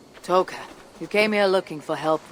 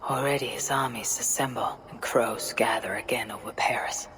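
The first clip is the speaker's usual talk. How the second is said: soft, low voiceover of the journey; hushed story narration; 5 semitones lower, 5 dB quieter than usual. A narrator's reading. Eivor narrates the coming war in Francia. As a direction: tell the history quietly.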